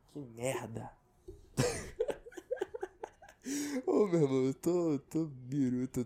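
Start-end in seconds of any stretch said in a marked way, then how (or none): 1.6-3.1 a high, breathy little giggle. Real, not acted. Laughing at his own rambling, a high giggle. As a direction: laugh it off at yourself.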